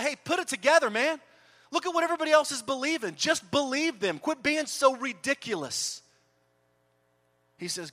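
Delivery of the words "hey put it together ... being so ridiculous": loud, high, scornful telling-off; mocking scold. Preacher mocks a Jesus who scolds Thomas, loud and scornful. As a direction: scoff at him like an impatient buddy. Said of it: "He didn't ridicule Thomas and say".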